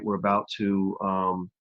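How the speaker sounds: normal talking voice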